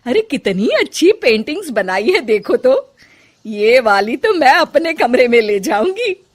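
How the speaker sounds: loud, lilting, impressed matriarch; excited, admiring mother-in-law voice; delighted mother-in-law. Acted. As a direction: exclaim proudly over the paintings.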